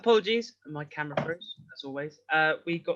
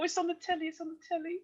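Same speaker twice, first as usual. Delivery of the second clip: hushed, high-pitched mock excitement; little high voice, quietly giddy; excited telly watcher; 11 semitones higher, 9 dB quieter, faster than usual. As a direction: softly squeak it like an excited viewer. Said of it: "He's like".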